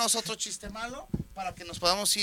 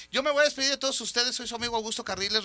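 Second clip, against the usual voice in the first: fast; 4 semitones higher, faster than usual. Real, not acted. Rushed sign-off.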